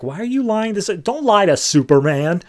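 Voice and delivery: high-pitched indignant reproach; scolding. Retold or imitated. Huntress catching Batman lying to Superman, scolding.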